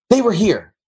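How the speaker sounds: high, commanding mock producer; retold producer ordering him to sit; bossy producer voice.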